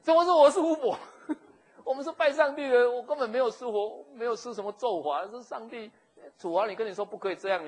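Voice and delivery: high, loud offended protest; indignant retort. Acted. Sarai protests loudly that God punished him. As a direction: fire back, insulted. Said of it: "我上来说".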